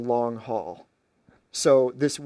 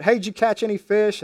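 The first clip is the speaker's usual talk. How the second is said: higher-pitched mock call-out; mild yell; 6 semitones higher, 4 dB louder than usual. Stranger on the shore to fishermen, mild yell. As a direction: call it out lightly, as if to someone off a way. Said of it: "yelled out".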